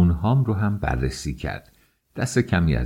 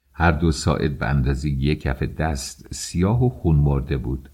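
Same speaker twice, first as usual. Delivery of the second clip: ordinary delivery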